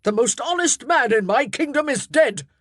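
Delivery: loud, high grief-stricken rage; furious. Acted. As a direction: hurl it at her. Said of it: "he rounded on her in fury".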